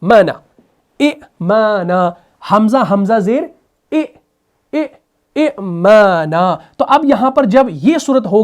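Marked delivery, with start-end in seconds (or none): 0.0-6.6 ए माना said very slowly with a stop before माना; jerked hamza demo. Shown as a demonstration. Teacher demonstrating the hamza with a jerk. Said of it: "same यहां पर भी होता है".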